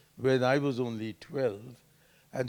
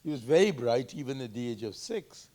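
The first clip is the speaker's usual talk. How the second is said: ordinary talk, nothing marked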